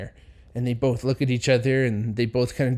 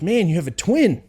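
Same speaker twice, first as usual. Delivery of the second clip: higher and louder, bursting with the news; excited announcement; 6 semitones higher, 5 dB louder than usual. Retold or imitated. Friend bursting in to tell Bobby, excited. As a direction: blurt it out like it can't wait.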